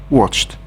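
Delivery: "watched" ending in a voiceless t. Shown as a demonstration. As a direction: pronounce "watched" as one syllable, watcht. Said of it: "the E D is pronounced".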